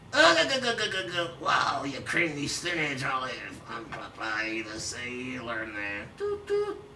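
lightly voiced cartoon sailor; Popeye. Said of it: "That Popeye impression sucked".

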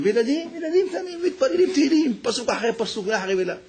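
put-on choked with joy at the children's prayer; emotional retelling. Teacher tells father how little children prayed, overcome. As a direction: voice jumps high with laughing-crying emotion.

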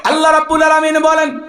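high, ringing announcement; melodic preaching. Acted. Preacher announcing God's word, high melodic preaching. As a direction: call it out high on a held note.

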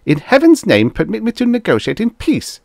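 high, loud exasperation; snapped. A narrator's reading. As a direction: snap at an interrupting underling. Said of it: "Nitworth snapped".

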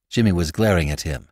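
ordinary delivery, nothing marked

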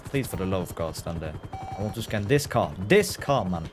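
ordinary delivery

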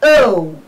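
O as a long "uh-oo" glide; sustained teaching O; diphthong demo. Shown as a demonstration. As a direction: slide O from schwa to oo. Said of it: "We pronounce it as".

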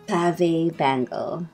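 ordinary talk

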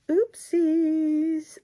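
put-on high playful Valley Girl "Oopsies.". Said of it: "Don't know where my, like, Valley Girl voice".

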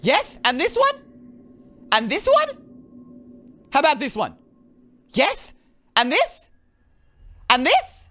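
rising thrill, this is actually working; building to an amazed shout of "It's a giant map"; growing excitement. Real, not acted. Maps appear one by one.

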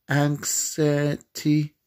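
"anxiety" sounded out slowly, piece by piece; sounding out. Real, not acted. Player working out how to spell it, slowly sounding out "anxiety". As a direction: stretch "anxiety" slowly, feeling for each part. Sounding out the word while typing.

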